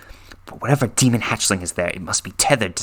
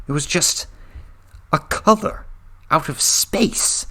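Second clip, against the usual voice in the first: dramatic title reveal; 7 semitones higher than usual. A narrator's reading. Climactic title phrase.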